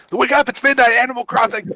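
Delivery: high, loud wake up call; excited wake up call. Retold or imitated. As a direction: call him awake, thrilled. Waking kid up at midnight.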